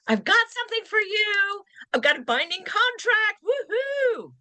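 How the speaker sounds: bright celebrating, pitched well up; excited woohoo. Shown as a demonstration. Listing agent cheering to the buyer's agent, excited. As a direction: cheer it out like great news. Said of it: "which could be".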